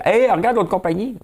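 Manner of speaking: very high-pitched squawked heckle; mock exclamation. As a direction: pipe up in a high, excitable mimicking voice. Mimics someone calling out about a rival.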